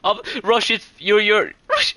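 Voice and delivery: urgent shout. Real, not acted. Desperate push in firefight.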